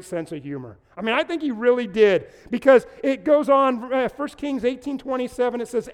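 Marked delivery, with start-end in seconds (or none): none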